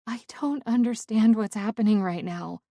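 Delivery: ordinary delivery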